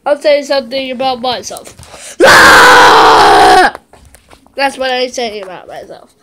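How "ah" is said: unvoiced shout. Voicing mother's answer, shouting.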